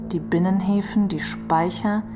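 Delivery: ordinary talk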